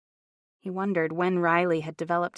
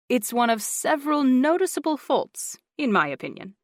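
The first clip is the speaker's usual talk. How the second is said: teasing English lilt, higher; English accent; 6 semitones higher, 5 dB louder than usual. A narrator's reading. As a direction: wink through it, English-style. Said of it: "English lilt".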